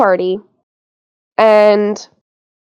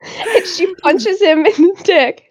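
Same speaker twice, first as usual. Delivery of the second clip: laughing; 7 semitones higher, slower than usual. Real, not acted. Laughing while recounting scene.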